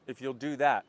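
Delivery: ordinary talk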